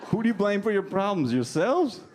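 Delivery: high-pitched, teasing disbelief; mock incredulous. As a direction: question them in playful, high-pitched astonishment. Teasing an atheist crowd.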